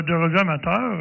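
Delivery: ordinary delivery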